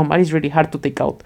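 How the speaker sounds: normal talking voice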